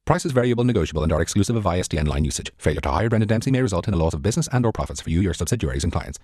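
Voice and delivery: rushed small-print read; fast disclaimer. Acted. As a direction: rattle off the legal small print.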